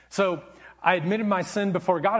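ordinary talk

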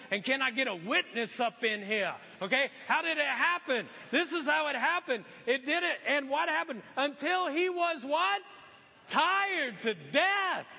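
high, loud call to the congregation; full-force preaching. Real, not acted. Preacher demanding a witness from the congregation, fired-up preaching. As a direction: rouse the room with punched questions.

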